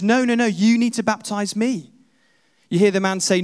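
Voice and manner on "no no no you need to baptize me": higher-pitched, insistent protest; protesting refusal. Acted. John refuses to baptize the stranger, protesting. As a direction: refuse quickly and firmly, as if taken aback.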